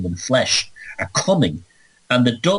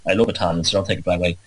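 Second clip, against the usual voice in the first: ordinary talk, nothing marked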